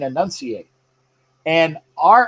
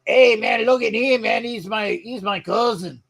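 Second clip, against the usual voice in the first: mock Mexican accent; 7 semitones higher than usual. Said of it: "Mexicans will look and say".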